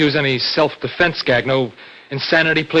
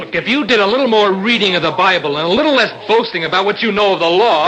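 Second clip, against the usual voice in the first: higher, louder heated retort; angry argument; 6 semitones higher, 5 dB louder than usual. Acted. Student rebuking his boastful brother, heated and raised. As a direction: throw it back at him in anger. Staged quarrel between feuding brothers.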